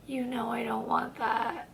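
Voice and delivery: very soft, slow reply; barely voiced, drawn-out turn-down; hushed refusal. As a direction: let it out quietly and slowly. Retold turning down of a kiss.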